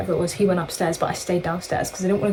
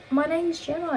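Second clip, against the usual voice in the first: put-on high-pitched, softer Australian accent; 9 semitones higher, 5 dB quieter than usual. Telling strangers a name to trick them, Australian accent. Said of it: "we tricked them that we were Australian".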